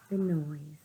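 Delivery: usual speaking voice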